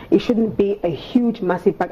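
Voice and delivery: ordinary delivery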